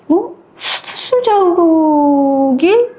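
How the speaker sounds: slow, tearful and high; choked-up, drawn-out cry. A narrator's reading. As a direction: break into tears at the discovery. Discovers the missing surgical scar.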